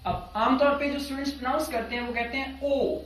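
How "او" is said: او as one pure, unglided "o"; wrong student O. Shown as a demonstration. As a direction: say او level, like the Urdu vowel. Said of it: "او is wrong".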